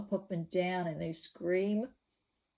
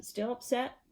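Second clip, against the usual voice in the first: plain reading voice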